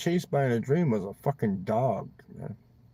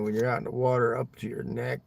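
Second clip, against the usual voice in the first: ordinary delivery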